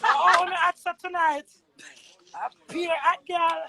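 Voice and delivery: high, loud burst of excitement; excited outburst. Real, not acted. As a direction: shout it out, fired up.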